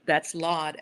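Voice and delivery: normal talking voice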